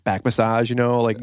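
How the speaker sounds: ordinary talk, nothing marked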